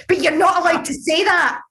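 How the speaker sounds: laughing while talking, pitch up. Real, not acted. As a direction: laugh it out, half rueful. Laughs at her own frustration.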